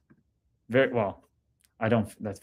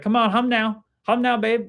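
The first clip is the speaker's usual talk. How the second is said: a light take on a hockey buddy, higher pitched; Jake Dotchin impression; 9 semitones higher than usual. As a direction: tease with his catchphrase in a higher voice. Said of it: "He'd always talk like that".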